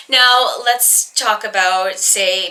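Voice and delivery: normal talking voice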